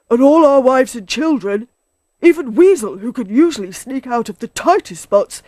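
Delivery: worked-up storybook badger; agitated Badger. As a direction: list everyone in trouble, despairingly.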